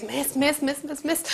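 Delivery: high, quick, eager hand-up miss miss; eager schoolgirl. Retold or imitated. Rival schoolgirl begging the teacher's attention, high and eager. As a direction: mimic a keen girl desperate to be picked. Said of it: "she was like".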